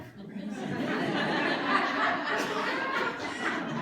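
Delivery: long, high delighted laugh; high loud laugh. Real, not acted. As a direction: laugh loudly at your own punchline. Laughs at punchline.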